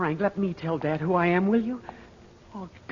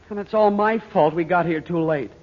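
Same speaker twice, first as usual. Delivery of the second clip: louder, anguished self-blame; self-blaming outburst; 9 dB louder than usual. Acted. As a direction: blurt out the guilt. Son blames himself for failing.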